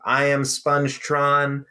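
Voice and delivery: slow, measured robot announcement; Spongetron robot. Acted. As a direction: introduce yourself slowly as a cartoon robot. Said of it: "I love his just robotic face".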